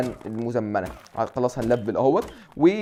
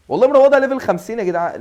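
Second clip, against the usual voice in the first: higher and louder bragging; mock boast; 8 semitones higher, 7 dB louder than usual. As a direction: brag jokingly to the viewers. Mock boast about turtle level. (تفاخر ساخر)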